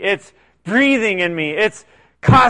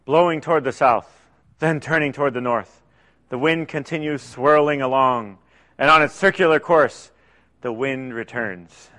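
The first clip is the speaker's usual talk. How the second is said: lower, measured reading of the verse; scripture reading; 6 semitones lower, 3 dB quieter than usual. Acted. Preacher demonstrating reading one verse, calm and measured.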